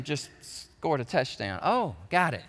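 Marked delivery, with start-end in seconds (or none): none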